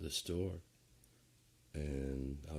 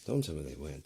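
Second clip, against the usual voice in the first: ordinary talk, nothing marked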